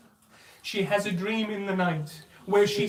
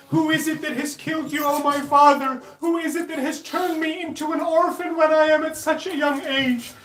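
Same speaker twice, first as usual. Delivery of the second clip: put-on higher and louder, a grieving child's lament; crying out in grief; 5 semitones higher, 4 dB louder than usual. A narrator's reading. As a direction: wail it out like a child at her father's body. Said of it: "and she cries out".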